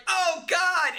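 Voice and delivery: mock alarmed gasp. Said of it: "and he's like".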